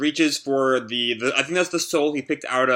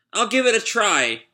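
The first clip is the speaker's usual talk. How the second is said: put-on child voice; 8 semitones higher than usual. Child promises to be brave.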